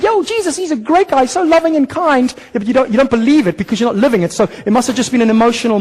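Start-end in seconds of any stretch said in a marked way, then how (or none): 0.0-0.6 much higher, cheesy yo; a nine-year-old's praise mocked; mock childish praise. 0.6-2.3 higher, breezy mock believer; a careless believer bragging about a moment at age nine; mock casual believer. Mock nominal believer voiced.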